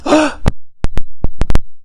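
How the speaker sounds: big, loud gasp of delight; amazed gasp. Acted. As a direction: suck in air, acting overwhelmed. Alien gasps at offered money.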